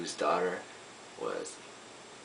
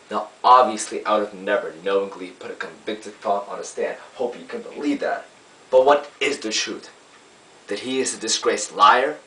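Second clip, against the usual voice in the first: emphatic courtroom argument, louder on key words; lawyer's summation; 6 dB louder than usual. Acted. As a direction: press the jury with rising rhetorical questions. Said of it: "I play a lawyer".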